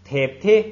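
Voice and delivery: tip, tip each rising at the end; tone demo. Shown as a demonstration. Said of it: "咱们来读啊".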